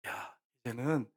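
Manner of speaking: soft, slow and low, coaxing gently; hushed, patient fatherly voice; warm, loving father. Acted. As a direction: speak tenderly to a frightened child. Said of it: "따뜻한 눈으로 바라보면서, 사랑의 눈으로".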